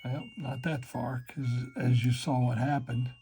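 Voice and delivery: usual speaking voice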